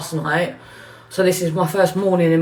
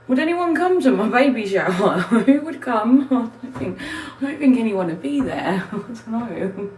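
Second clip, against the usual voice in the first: laughing it off; 3 semitones higher than usual. Real, not acted. Laughing at own baby shower idea.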